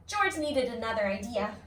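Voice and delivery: ordinary delivery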